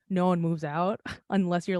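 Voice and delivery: ordinary talk, nothing marked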